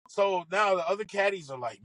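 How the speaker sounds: ordinary talk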